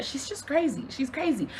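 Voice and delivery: softly tossed-off retelling; hushed nonchalant quote; calm, unbothered. Retold or imitated. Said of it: "like it's not even that deep".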